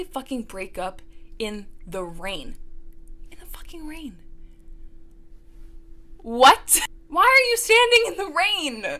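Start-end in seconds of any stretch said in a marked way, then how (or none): 3.3-4.2 whispered in disbelief; stage whisper. Real, not acted. Mocking the rain breakup, whispered. Mock hushed aside. 6.2-9.0 loud, much higher incredulous shout; outraged disbelief. Real, not acted. Mocking the rain breakup, loud incredulous shout.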